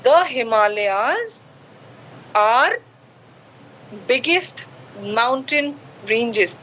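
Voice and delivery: very slow, every word drawn out; slow model sentence. Shown as a demonstration. Teacher dictating the corrected sentence, very slow. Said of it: "तो आप इसको बोलोगे".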